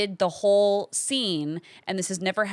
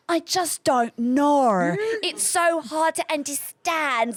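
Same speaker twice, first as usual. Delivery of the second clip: bad British accent; 5 semitones higher, slower than usual. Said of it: "my British accent".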